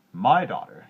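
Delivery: loud, proud miller; a proud father boasting loudly; miller's boast. A narrator's reading. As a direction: brag it to the king. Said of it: "he said".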